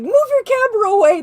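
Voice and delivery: laughing yell, high and loud. Retold or imitated. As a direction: shout it through laughter. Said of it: "I kept yelling".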